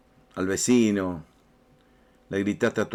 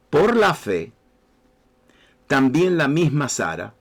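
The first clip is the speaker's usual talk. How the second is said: slow, raised, solemn Bible reading; scripture reading; 5 semitones higher, slower than usual. Acted. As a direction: proclaim the verse slowly to the congregation. Reads Hebrews aloud.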